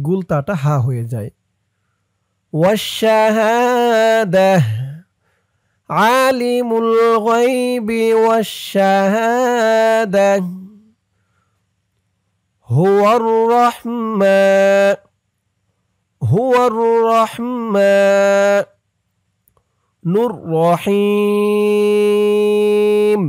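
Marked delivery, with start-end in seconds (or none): 2.5-18.6 slow, chanted tajweed recitation; Quran recitation. Shown as a demonstration. Teacher recites verse again. 20.3-23.2 الرحيم held long at the stop, three alif lengths; three-count madd on الرحيم; elongated stop. Shown as a demonstration. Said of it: "তিন আলিফ টান দিয়ে এখানে অক্ফ করতে হবে".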